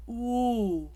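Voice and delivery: slow, forward oo; forward French oo. Shown as a demonstration. Coach demonstrating French oo to singers. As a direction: push the oo to the front of the mouth. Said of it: "the sound to come forward".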